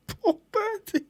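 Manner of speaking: high wavering half-laughing sob; mock crying. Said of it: "Are you crying or are you?".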